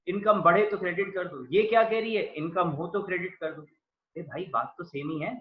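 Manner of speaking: ordinary talk, nothing marked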